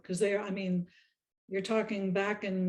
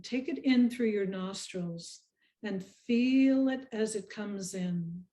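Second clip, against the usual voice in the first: guided meditation. Acted. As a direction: lead them gently through each breath, unhurried.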